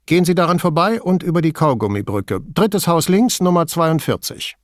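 high-pitched, businesslike character voice; brisk woman on phone. Acted. Signora Toso giving police directions by phone, brisk.

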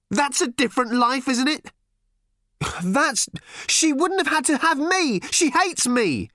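high, anguished outburst; furious retort. A narrator's reading. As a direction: throw it back in a high, hurt rage. Daughter rages back at her father.

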